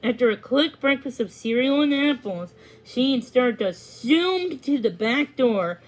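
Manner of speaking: ordinary delivery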